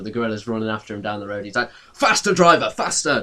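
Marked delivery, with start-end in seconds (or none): 1.9-3.2 higher, louder urging; urgent plea. Acted. The man begs the bus driver to speed away from the gorilla. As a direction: hurry the driver along in a panic.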